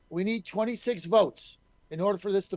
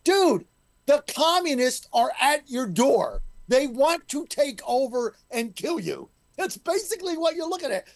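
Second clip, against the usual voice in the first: exasperated rant; 6 semitones higher than usual. Real, not acted. Mocking colleague, exasperated outburst.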